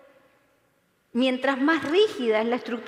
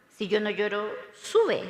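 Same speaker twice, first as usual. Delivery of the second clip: rising sube; 6 semitones lower than usual. Shown as a demonstration. Widow mocking the advice, sube jumping up. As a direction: lift sube up like the soul rising. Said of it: "O sea".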